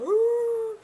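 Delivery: high loud dog howl; bay like a beagle; imitating beagle baying. Owner imitating her beagle baying for the camera. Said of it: "When they go".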